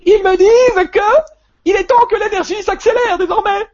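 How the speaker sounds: very high quavering little voice, loud; Monique Mathieu. Retold or imitated. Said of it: "elle a une petite voix chevrotante".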